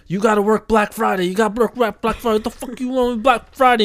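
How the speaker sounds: hammed-up boss barking orders; mock boss yelling. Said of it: "the Indian guy's yelling at Daryl".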